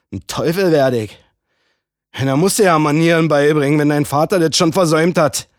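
laut und drohend; drohender Mann. Acted. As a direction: droh ihr unverhohlen. Mann droht. (laut und bedrohlich)

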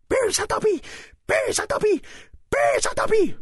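very high-pitched self-scolding shriek; frantic elf cry. Acted. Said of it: "schrie er".

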